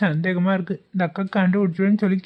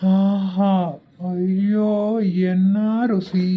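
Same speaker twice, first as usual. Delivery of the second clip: drawn-out swoon of relish; blissful food-lover's sigh; savouring delight; much slower than usual. Acted. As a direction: linger dreamily over each word. Sniffing out and savouring the hidden sambar.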